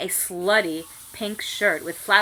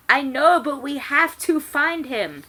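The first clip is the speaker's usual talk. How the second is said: mock smart; 7 semitones higher, 7 dB louder than usual. A narrator's reading. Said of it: "I said smartly".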